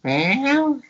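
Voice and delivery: high mock meow; a squeaky cat reply; imitating Pixel's meow, high. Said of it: "she'll reply something".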